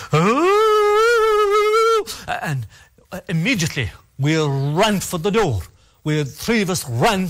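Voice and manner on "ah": a high, long keening cry; lonesome banshee wail. Retold or imitated. Teller imitating the banshee crying outside the house, high keening wail. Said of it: "This lonesome, lonesome, this, this".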